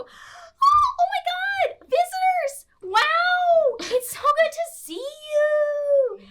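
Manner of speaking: very high, loud, slow gushing squeal; shrieking high-pitched excited friar; vocal friar greeting. Acted. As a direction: greet the visitors with over-the-top delight. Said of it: "vocal friar".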